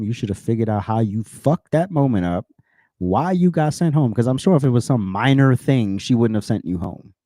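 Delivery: ordinary talk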